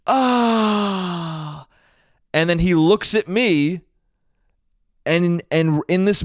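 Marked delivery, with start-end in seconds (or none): 0.1-1.7 imitated higher, long falling "ahh"; mock groan of someone nursing a sore head; pained groan. Boyfriend watches hurt girlfriend, imitated pained groan. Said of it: "he looks at her holding her head".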